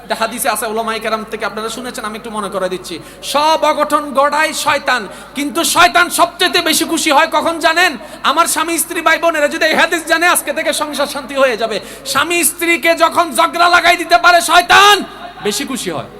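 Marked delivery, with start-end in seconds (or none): 3.2-15.0 high, loud sermon delivery building to a shout; fiery preaching. Acted. Preacher warning husbands and wives about Satan, fiery. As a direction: preach it out to the whole crowd.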